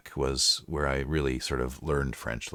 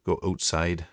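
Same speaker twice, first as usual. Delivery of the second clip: Canadian accent. Shown as a demonstration. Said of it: "As you can probably tell if I say".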